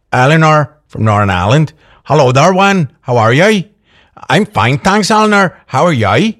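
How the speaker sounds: put-on Northern Irish lilt; hammed-up Northern Irish greeting; Northern Irish accent. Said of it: "I'm losing the accent now".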